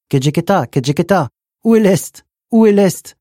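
high, loud birdlike calling; bird call. Acted. Bird call voiced in the story.

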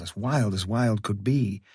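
ordinary delivery, nothing marked

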